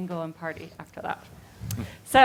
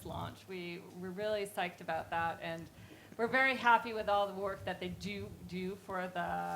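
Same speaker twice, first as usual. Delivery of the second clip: host welcoming a crowd; in front of an audience; 7 dB quieter than usual. Real, not acted. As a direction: greet the audience warmly.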